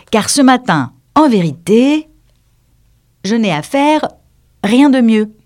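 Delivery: high, loud, measured prosecutor; sly accuser declaiming a verse; crafty Furry. A narrator's reading. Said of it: "le rusé Furry".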